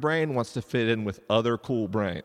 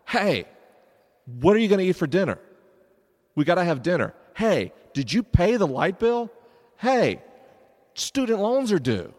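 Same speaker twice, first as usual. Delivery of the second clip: high, loud, pestering interruptions; nagging monkey brain; 9 semitones higher, 7 dB louder than usual. Acted. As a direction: keep butting in with urgent reminders, pauses between. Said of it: "Your monkey brain was like".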